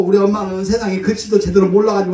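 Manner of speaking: ordinary talk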